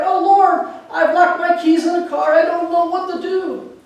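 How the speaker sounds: high, loud pleading prayer. Retold or imitated. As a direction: cry out to the Lord. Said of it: "she was a loud prayer".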